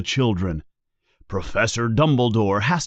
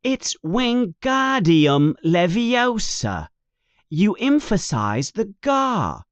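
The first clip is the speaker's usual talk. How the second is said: put-on higher, slower, irritated boy voice; Ron correcting her; 8 semitones higher than usual. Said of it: "says Ron angrily".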